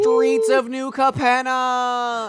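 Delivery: high, slow, drawn-out hype; grand announcer voice. Acted. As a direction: announce it like a big reveal. Hyped set announcement.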